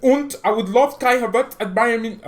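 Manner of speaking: high, loud imitation of a German accent. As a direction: quote the coach in a hammed-up German accent. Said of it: "saying in a press conference with his German accent".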